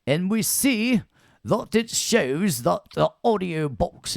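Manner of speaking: hammed-up British accent, swooping high and loud. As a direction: lecture in an exaggerated British voice. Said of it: "talking British when I was talking about".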